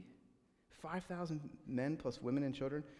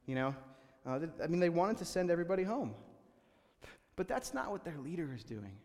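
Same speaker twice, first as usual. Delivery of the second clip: usual speaking voice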